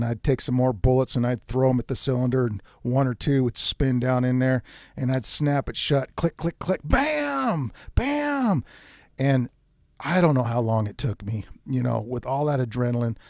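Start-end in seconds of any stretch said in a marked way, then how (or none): 6.2-8.6 clicks then much higher bams, like the revolver firing; mock gun noises, bams up high; imitated gun clicks and shots. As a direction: act out the hammer clicking and the gun going off.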